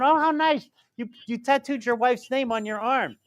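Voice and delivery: high, gushing mock admiration; imitated admirers. Man imitates everyone admiring his wife-name tattoo, high gushing voice. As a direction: coo it high, imitating delighted onlookers. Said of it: "Everyone's going".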